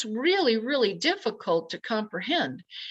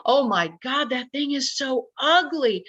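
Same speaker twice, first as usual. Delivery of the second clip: retold scream; 5 semitones higher than usual. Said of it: "And I screamed".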